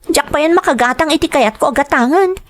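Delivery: high-pitched, fast indignant complaint; angry complaint. Acted. Mistress complains to Meliton about her allowance, indignant.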